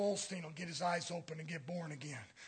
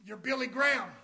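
ordinary talk

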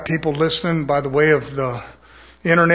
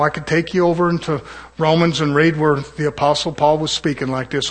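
ordinary talk

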